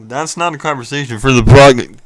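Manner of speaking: booming into the microphone; shutting the topic down, mouth on the mic; right up on the mic. Real, not acted. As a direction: lean in and blast the mic. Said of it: "Get away from the microphone".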